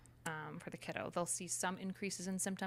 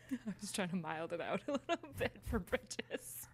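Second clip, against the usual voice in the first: talking through real laughter, slowed by it; laughing; slower than usual. Real, not acted. Host admitting they softened the game for Bridges, laughing. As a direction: let laughs break up the words.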